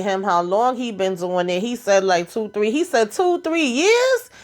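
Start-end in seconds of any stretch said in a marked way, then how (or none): 3.1-4.3 high, drawn-out disbelieving question; incredulous echo. Retold or imitated. Shocked dad repeats his son's answer, mock incredulous. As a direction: repeat it back, stretched and shooting up in pitch.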